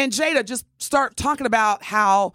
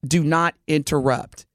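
firm instruction; 5 semitones lower than usual. Retold or imitated. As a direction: lay down the law to the server. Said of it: "I will tell the server".

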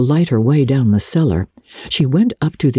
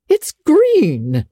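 shocked. A narrator's reading. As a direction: gasp it out in disbelief. Marilla shocked at the hair.

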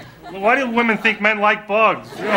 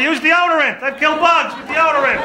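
higher, insistent wife voice; wife urging husband, higher mock insistence; 10 semitones higher than usual. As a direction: imitate the wife's know-it-all advice. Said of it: "She says".

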